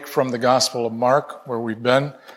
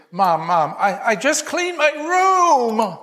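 put-on high, slow, anxious child's voice; frantic little boy; 8 semitones higher, slower than usual. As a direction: play the panicked little boy, pitched way up. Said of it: "he said frantically".

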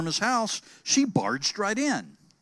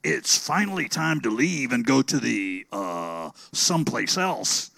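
booming papa dinosaur; 6 semitones lower than usual. Acted. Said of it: "in his loud booming voice".

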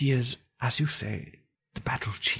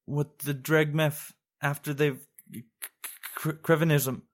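uncertain; 9 semitones higher than usual. A narrator's reading. Young lass struggling to answer the sorcerer, uncertain. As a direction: stumble over a half-remembered word. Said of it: "said Lilatha uncertainly".

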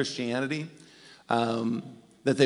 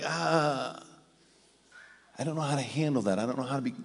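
softer, fast, flustered; mock awkward; 5 dB quieter than usual. Churchgoers facing a stigma they can't handle, flustered. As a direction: fumble for words, uneasy.

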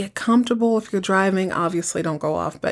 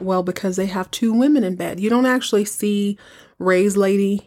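ordinary talk

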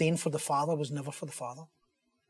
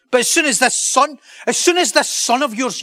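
much higher and louder, indignant complaint; angry older brother; 9 semitones higher, 9 dB louder than usual. Acted. Older brother complaining to his father, high indignant shout. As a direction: pile up each grievance, voice climbing high. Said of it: "But he was angry".

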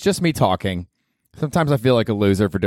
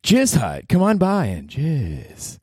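slow, high jingle-like ad read; hammed-up ad tagline, stretched out; mock commercial; 6 semitones higher, much slower than usual. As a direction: sell it with drawn-out, raised pitch. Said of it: "episode with a commercial".

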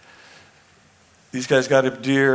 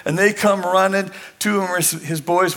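usual speaking voice

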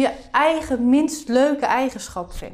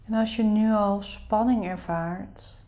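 soft spa whisper; 3 semitones lower, 9 dB quieter than usual. Acted. Leads a guided meditation.

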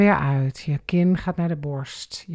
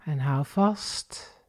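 very slow and soft; slow breath cue; 3 semitones lower, 3 dB quieter, much slower than usual. Shown as a demonstration. Instructor slowing right down for the held breath. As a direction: stretch the words out like a long breath. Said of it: "Adem in".

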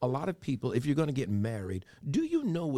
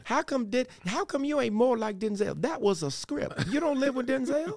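high mocking voice; 13 semitones higher than usual. Mocking a wife's complaint.

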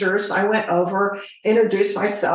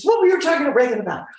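jealous captain grilling the flight attendant, quick and huffy; put-out captain; 6 semitones higher, much faster than usual. Retold or imitated. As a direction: rattle it off like a miffed captain. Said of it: "the captain was not a happy little camper".

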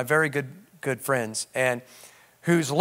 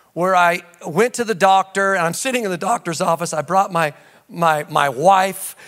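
normal talking voice

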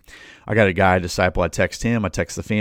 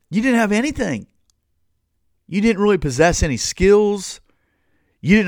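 high scolding voice, pitched up; scolding Israel; 8 semitones higher than usual. Acted. Preacher scolding the wandering people, high and scolding. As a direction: scold them like a fed-up leader.

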